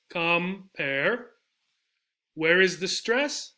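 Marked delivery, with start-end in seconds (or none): 0.1-1.3 compare in two beats, "com ... pare"; compare, pulled apart with a rising end; split into syllables. Shown as a demonstration. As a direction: pause between the syllables of compare. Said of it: "Sort of like this".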